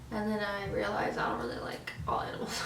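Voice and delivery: soft whispered confession; a sheepish whispered aside. Real, not acted. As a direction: let it slip in a whisper. Confiding aside about disliking animals.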